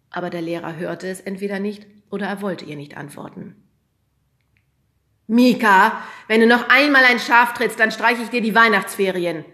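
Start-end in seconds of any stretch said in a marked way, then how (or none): 5.3-9.4 loud warning call; teacher calling out. A narrator's reading. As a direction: threaten the shepherd loudly across the room. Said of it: "rief der Lehrer".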